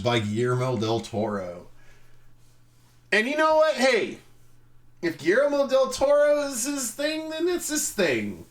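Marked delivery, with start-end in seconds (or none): 3.1-8.4 much higher and louder; mock generous. Conceding his weird taste, high and loud. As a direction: throw it up high, shrugging it off.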